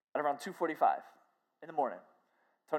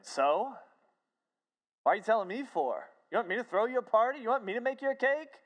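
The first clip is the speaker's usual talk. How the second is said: mock-indignant questions, climbing higher and quicker; retold snide retort; 7 semitones higher, 3 dB louder than usual. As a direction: snap back as the unimpressed friend. Retold snide reply from a woman.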